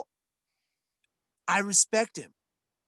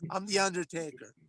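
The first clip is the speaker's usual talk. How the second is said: mock Undertaker; 2 semitones lower, 6 dB quieter than usual. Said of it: "he'll be like".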